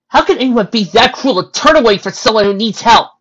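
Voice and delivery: loud, high-pitched disbelief; appalled outburst. Acted. Pony appalled at a cruel tale, loud indignant outburst. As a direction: burst out in indignant shock.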